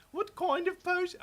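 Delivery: hammed-up Aussie questioning; put-on Australian accent. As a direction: exaggerate the Australian vowels, loudly. Mocks game's potion talk.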